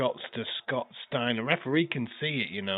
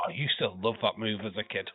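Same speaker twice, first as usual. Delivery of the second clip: normal talking voice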